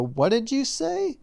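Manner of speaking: high, drawn-out mock question; imitated puzzled listeners. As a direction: mimic people who couldn't hear you, slow and high. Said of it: "people go".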